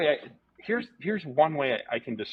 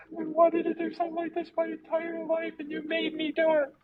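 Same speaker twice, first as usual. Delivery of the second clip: put-on much higher, teary girl's voice; tearful girl. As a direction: squeak it out like an overwhelmed crying girl. Crying girl quoted on stage.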